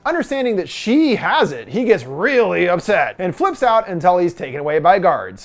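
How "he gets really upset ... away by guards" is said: usual speaking voice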